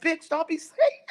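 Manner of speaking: high-pitched indignant retort, imitated; mock scolding. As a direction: scold like an offended friend, half laughing. Acts out Demetra scolding her.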